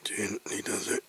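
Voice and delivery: soft whisper, concentrating. Real, not acted. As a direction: whisper to yourself carefully. Whispering while working carefully.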